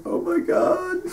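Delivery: very high squealed oh my god; horrified squeal. Retold or imitated. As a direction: squeak out your dismay. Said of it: "Azula's like".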